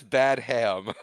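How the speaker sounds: "Bad Ham" as two separate slow words; pronounced as spelled. Shown as a demonstration. Reading director's name wrongly, "Bad Ham" as two words. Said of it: "That's not how you pronounce it".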